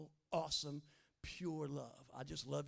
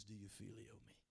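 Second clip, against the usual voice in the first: much lower, softer Jesus; gentle soft question; 10 semitones lower, 13 dB quieter than usual. Retold or imitated. Jesus asking Peter a third time, soft gentle question. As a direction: meet him gently, almost under the breath.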